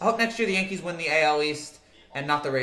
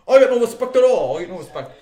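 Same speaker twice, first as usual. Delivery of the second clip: loud comic catchphrase, falling away on the repeat; Rodney Dangerfield; 5 semitones higher, 9 dB louder than usual. Acted. Streamer doing Rodney's catchphrase for chat, comic impersonation. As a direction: blurt the complaint loudly, then let it drop. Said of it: "I'm trying to do Rodney".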